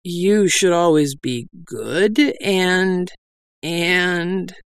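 slow, faltering sermon voice; hesitant. A narrator's reading. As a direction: stall and search for words. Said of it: "Doll said uncertainly".

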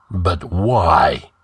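mildly puzzled question; understated confusion; bewildered. A narrator's reading. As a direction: ask it with a touch of bewilderment. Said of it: "bewilderedly asked Conan".

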